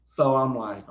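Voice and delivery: normal talking voice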